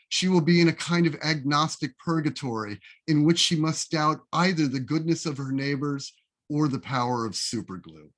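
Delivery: usual speaking voice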